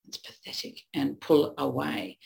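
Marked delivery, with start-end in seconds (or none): 0.1-0.8 contemptuous aside. Retold or imitated. Neutral judging partner's tears.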